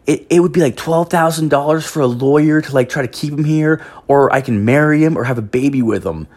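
a hint of a naive young woman listing options; imitating girlfriend's friend. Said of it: "she's like".

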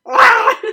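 imitating a sudden angry cry, high and loud; short mock battle cry; mock angry yell. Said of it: "You were like".

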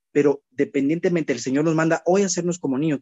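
ordinary delivery

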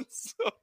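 high squealing laugh, then a breathless wheeze; squealing laugh fading to breathless wheeze. Real, not acted. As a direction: lose it laughing at the story's end. Laughing at the punchline.

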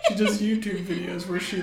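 usual speaking voice

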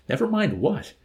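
high, questioning lift; innocent puzzlement. A narrator's reading. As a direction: ask back obliviously, pitched high. Oblivious Arfie asks back.

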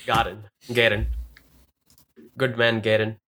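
a hint of Indian accent, Garen said as "Gadən"; playful mock Indian accent, low and easy. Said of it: "get into the Indian accent".

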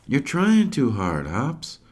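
put-on slow, high child voice, lightly done; know-it-all little girl. Sage advising her brother, slow, high and a bit smug. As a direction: lecture your brother like an expert. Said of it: "Sage says".

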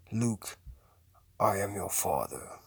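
low, soft villain voice; deep, hushed film-villain line; film villain quote. Acted. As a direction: drop low and intone it like a movie villain. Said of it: "That's iconic shit".

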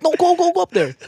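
high excited urging; urging shout. Retold or imitated. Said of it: "they're like".

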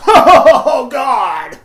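high, loud laughing "Oh, God"; loud mocking laugh of a customer. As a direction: cackle it out like an annoying customer. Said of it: "they'll do this little laugh".

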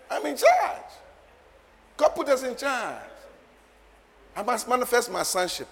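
loud, high, commanding declaration; forceful preaching command. Real, not acted. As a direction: command the earth like a fired-up preacher.

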